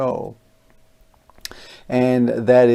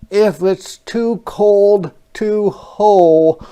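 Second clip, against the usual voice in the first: high, loud, slow pig voice; a snug farm pig; storybook pig heading in; 8 semitones higher, 7 dB louder than usual. Acted.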